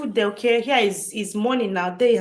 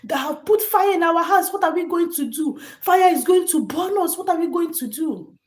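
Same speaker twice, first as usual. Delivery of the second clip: put-on high, loud, frantic cries of children; panicked kids shouting; 9 semitones higher, 5 dB louder than usual. Retelling trapped children calling their father, high frantic shouting. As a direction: cry out for daddy as the fire closes in. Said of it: "the children start shouting".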